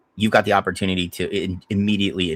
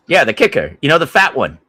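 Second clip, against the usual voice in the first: mock bossy owner, louder; Al Davis; 6 semitones higher, 7 dB louder than usual. Owner calls his GM on draft day, loud mock bossiness. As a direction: bark the order like a pushy old owner. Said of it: "Nothing, Mister Davis".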